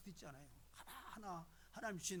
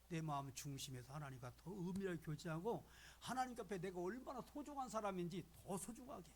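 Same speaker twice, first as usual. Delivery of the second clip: normal talking voice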